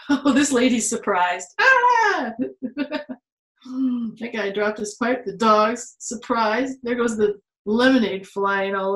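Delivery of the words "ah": high squeal breaking into laughter; laughing shriek. Real, not acted. Teacher squealing and laughing at a funny page. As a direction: squeal then laugh at the picture.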